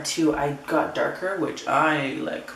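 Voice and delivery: ordinary talk, nothing marked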